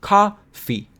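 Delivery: "coffee" split in two, COF... fee; high first beat, separated second in "coffee"; syllable counting. Shown as a demonstration. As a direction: drop the chin on each beat of "coffee". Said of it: "put your hand under your chin".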